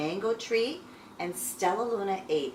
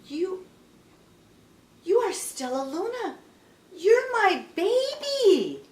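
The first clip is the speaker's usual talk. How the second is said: amazed joy; 10 semitones higher, 6 dB louder than usual. Acted. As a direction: build from wonder to a loud, joyful cry. Mother bat recognizes her lost baby.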